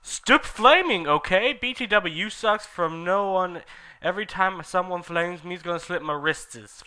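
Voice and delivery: indignant author. Acted.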